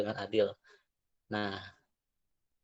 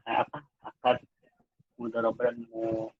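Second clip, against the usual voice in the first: normal talking voice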